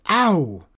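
high loud pained yelp. Acted. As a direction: yelp as you get bitten. Horse bites enchanter.

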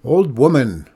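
loud, swooping hail; calling out. A narrator's reading. Old man on the ridge to woman below, calling out. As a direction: holler to her across the distance. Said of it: "He called out".

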